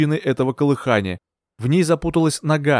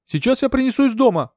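high-pitched shouted reply; calling back; 11 semitones higher than usual. A narrator's reading. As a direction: answer across a distance, urgently.

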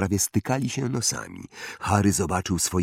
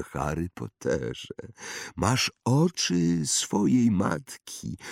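slow, higher wandmaker voice; eccentric old wandmaker, musing; 5 semitones higher than usual. Acted. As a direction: muse slowly, drawing out words with rises and falls. (ekscentryczny wytwórca różdżek, powolna zaduma)

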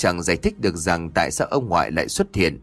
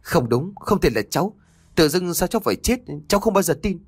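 rising, faster panicked outcry; refusing his fate, voice building up; desperate shout; 8 semitones higher than usual. A narrator's reading. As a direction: shout it in denial, climbing higher. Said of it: "Minh liền gào lên".

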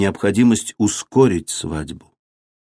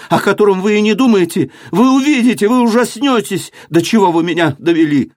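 defiance breaking out of despair; desperate outburst; 11 semitones higher than usual. A narrator's reading. Daughter threatens her father with a protector, desperate outburst.

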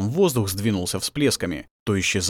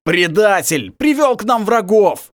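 loud slow furious roar; furious snarl; 10 semitones higher, 6 dB louder, slower than usual. Acted. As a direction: roar the accusation. Wolf snarls at traitor.

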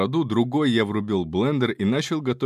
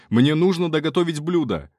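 higher, urgent shout for cover; shouted command; 5 semitones higher, 4 dB louder than usual. Acted. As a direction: call out to the allies. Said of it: "крикнул я".

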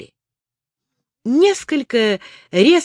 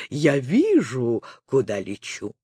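lowered, unbothered retort; confident reply; 8 semitones lower, 4 dB quieter than usual. A narrator's reading. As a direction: answer unhurried and sure. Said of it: "уверенно отвечала Лола".

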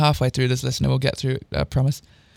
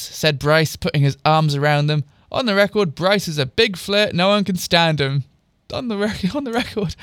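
plain reading voice

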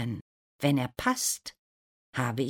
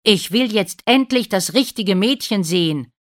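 loud, raised, angry demand; furious; 7 semitones higher, 10 dB louder than usual. A narrator's reading. Prince confronts the family over the false brides, furious. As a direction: demand it angrily, voice up. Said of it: "sagt wütend".

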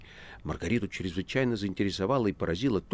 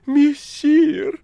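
very high, loud feigned alarm; phoney-anxious talking cat; falsely alarmed cat; 12 dB louder than usual. A narrator's reading. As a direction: squeal with put-on worry. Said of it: "тревожно фальшивым голосом отозвался кот".

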